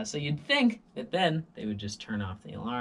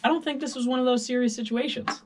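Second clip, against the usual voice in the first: sarcastic; 12 semitones higher, 7 dB louder, faster than usual. Real, not acted. Sarcastic complaint.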